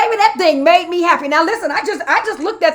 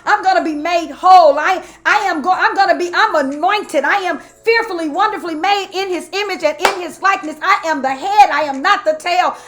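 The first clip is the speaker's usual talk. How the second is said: higher, rolling preacher declarations, building to a loud peak; fervent preaching; 4 semitones higher, 4 dB louder than usual. Real, not acted. Preacher declaring affirmations after trauma, fervent and building. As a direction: pile up the phrases like a sermon climax.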